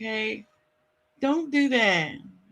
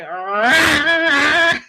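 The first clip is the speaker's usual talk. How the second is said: louder, higher playful rawr; imitated roar; 4 semitones higher, 6 dB louder than usual. Streamer recalling a catchphrase for chat, mock roar. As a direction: growl it out like him on camera. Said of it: "he used to be like".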